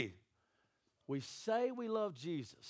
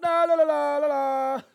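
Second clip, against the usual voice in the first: high, loud la-la-la; drowning out; 9 semitones higher, 14 dB louder than usual. Acted. People refusing to hear God, loud childish la-la. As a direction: blare it over the other speaker.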